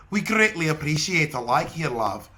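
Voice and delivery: put-on Irish accent; Rosé. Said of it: "That's Irish".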